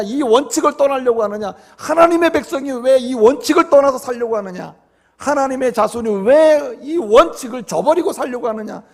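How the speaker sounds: impassioned preacher imitating a reprimand; acted scolding. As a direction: hammer each accusing question as the envoys. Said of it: "막 야단을 치고 얘기하고".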